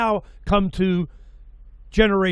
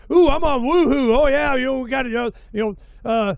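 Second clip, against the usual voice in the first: giddy commercial actor; 6 semitones higher than usual. Retold or imitated. Retelling an old commercial to his guest, hammed-up giddy ad voice. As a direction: ham up a cheesy TV spot character. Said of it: "they go".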